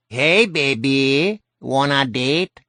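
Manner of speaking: cartoon Mexican accent, slow and loud; hammy Mexican accent drunk come-on; Speedy Gonzales. A narrator's reading. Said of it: "in a Mexican accent that made him sound like Speedy Gonzales".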